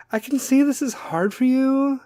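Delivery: very high mock-sympathetic voice; Kim K. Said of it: "is going to be all Kim K".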